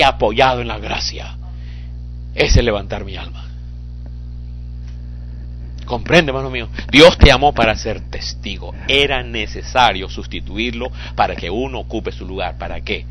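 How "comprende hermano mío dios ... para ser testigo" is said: usual speaking voice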